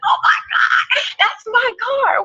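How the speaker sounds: high, shrieking joy at spotting the car; excited. Pretend. Excited squeal at seeing the dream car drive by. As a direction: squeal like you just saw your dream car.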